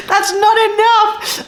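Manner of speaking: high, slow, hammed-up protest; mock outrage. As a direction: pretend to be scandalised at herself. Mock outrage at her own count.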